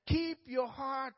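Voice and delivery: slow, loud and high, each word weighted; emphatic slow quote. Real, not acted. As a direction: lean on "Keep your heart" slowly. Preacher stressing scripture slowly.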